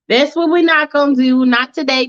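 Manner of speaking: usual speaking voice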